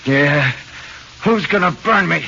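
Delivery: loud, high scornful retort; angry challenge. Acted. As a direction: hurl it back at the cop. Suspect challenges cop.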